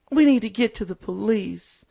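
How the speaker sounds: put-on high-pitched worried girl voice; imitating Andy. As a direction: lightly mimic a scared teen girl. Quoting Andy in the movie.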